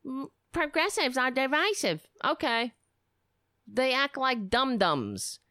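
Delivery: lightly mock the tweeter's whine; mocking Carl. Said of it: "like what Carl does".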